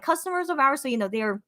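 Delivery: ordinary talk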